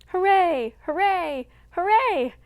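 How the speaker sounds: high, loud joyful shout; shouting hooray. Acted. Children waltzing round the barnyard, joyful shout. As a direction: cheer at the top of your voice. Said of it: "shouted the Woodlawn children".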